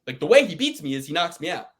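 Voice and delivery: ordinary talk, nothing marked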